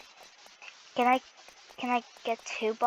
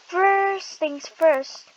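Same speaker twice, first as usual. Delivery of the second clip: slow and deliberate; 7 semitones higher, 7 dB louder, much slower than usual. Real, not acted. Explaining to viewer.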